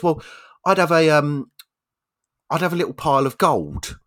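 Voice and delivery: put-on hesitant child answering in class; little girl pupil. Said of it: "little Susie puts her hand up".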